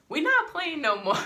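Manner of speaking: high, loud mock alarm from the girls; loud imitation of the girls realizing it's serious; girls' sudden alarm. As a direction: blurt it out as the girls catch on.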